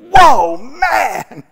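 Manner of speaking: loud, high Whoa, man!; amazed Adam. Acted. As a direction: shout it in wide-eyed wonder. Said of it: "was like".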